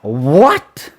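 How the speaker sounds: low start swooping up into a question; a drawn-out, incredulous rise; disbelieving. Real, not acted. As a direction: caught off guard, questioning. Disbelief at Luffy refusing food.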